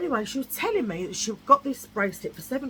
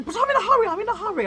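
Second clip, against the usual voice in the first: imitating flustered woman; 12 semitones higher, 7 dB louder, much faster than usual. Imitating Francois rushing.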